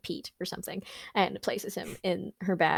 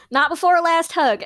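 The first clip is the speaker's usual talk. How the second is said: eager plea; 9 semitones higher, 10 dB louder than usual. Acted. Player begs for hug.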